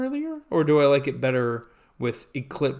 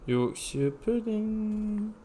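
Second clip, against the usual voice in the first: slow, higher, softer put-on Yorkshire accent; mock Yorkshire accent; 5 semitones higher, 6 dB quieter, much slower than usual. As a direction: draw it out in fake Yorkshire. Echoes the name in put-on accent.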